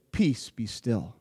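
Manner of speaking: low, slow, soft command; calm command. Acted. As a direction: still the storm with a hushed voice. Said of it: "he's able to lean forward and say".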